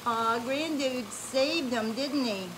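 ordinary delivery

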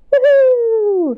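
high, loud woohoo; excited cheer. Real, not acted. Host cheering to open storytime for children. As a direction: cheer to kick things off.

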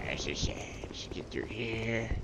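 soft whisper to himself. Real, not acted. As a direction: breathe the words out. Quiet moment after dying.

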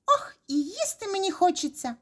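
high, hungry mouse plea; squeaking mouse. A narrator's reading. Second mouse wants to eat, squeaking. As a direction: squeak longingly for food. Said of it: "пищить верть".

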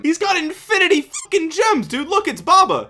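high-pitched gushing excitement; mock amazement. Hyping the ad man's endless gems, mock excited. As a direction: exclaim it way up high like an awestruck fan.

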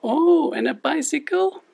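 squeaky, high cartoon puppet sidekick. Puppet co-host Lani speaks.

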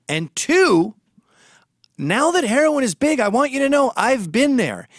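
real urgent warning to parents. Real, not acted. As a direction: hammer it home to worried parents.

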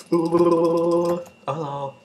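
loud, long teasing hello; drawn-out taunt. Real, not acted. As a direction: call out a stretched hello. Taunting an enemy in game.

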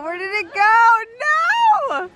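dismayed surprise. Real, not acted. Animal vanishes, dismay.

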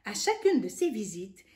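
ordinary delivery, nothing marked

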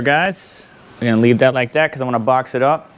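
ordinary talk, nothing marked

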